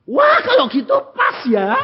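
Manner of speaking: high, loud delighted cry; excited shout. Acted. Preacher shouting for joy at the perfect timing, loud and high.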